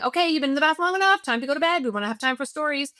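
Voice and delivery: put-on mother hurrying child out of the bath, brisk and high; brisk parent. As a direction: rattle off bedtime orders. Rushed parent orders.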